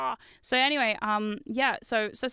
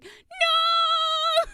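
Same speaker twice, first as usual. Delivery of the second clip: high, loud panicked squeal; hammed-up panicked shriek; mock scream; 4 dB louder than usual. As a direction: squeal it like a squeezed plush toy.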